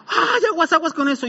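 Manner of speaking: put-on high, startled cry to scare a child; hammed-up yelp of alarm; mock alarmed warning. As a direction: imitate a sister's sudden fright. Imitates sisters scaring him with warning.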